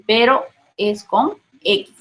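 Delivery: normal talking voice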